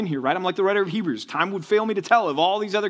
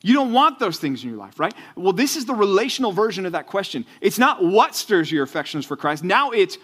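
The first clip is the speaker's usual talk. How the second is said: louder, higher, urgent preacher voice; fiery preaching; 7 semitones higher, 5 dB louder than usual. Acted. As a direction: drive the point home to the students. Preaching at full force.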